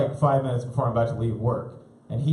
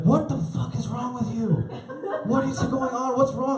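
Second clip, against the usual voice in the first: shocked parent; 9 semitones higher than usual. Retold or imitated. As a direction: recoil at the kid.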